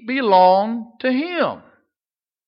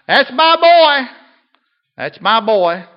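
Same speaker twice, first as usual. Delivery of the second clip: raised, loud proud shout, then easing; imitating a scream; 5 semitones higher, 8 dB louder, slower than usual. Preacher imitating a young man cheering at baseball, proud shout. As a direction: yell it from the bleachers. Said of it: "he would scream out".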